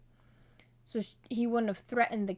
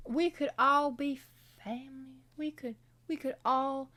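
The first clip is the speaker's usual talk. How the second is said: put-on Southern preacher accent; 6 semitones higher than usual. Cornbread preaching unity, in a Southern preacher accent. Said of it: "I keep doing the accent".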